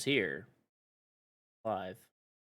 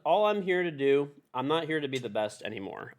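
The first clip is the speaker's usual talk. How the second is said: normal talking voice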